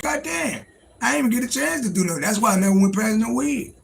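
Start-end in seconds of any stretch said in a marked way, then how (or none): 0.0-2.2 exasperated. Retold or imitated. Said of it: "I'm like".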